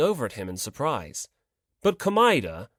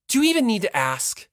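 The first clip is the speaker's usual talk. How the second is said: irritated; 7 semitones higher, 5 dB louder than usual. Acted. Hinata's curt angry reply.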